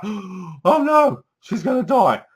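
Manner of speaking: a viewer's staged panic; mock alarm. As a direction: act startled for the audience.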